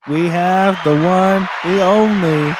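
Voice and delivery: big playful emcee build-up; show announcer. Pretend. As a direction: introduce the guest like a ring announcer, dragging out the last words. Emcee announces the guest's arrival.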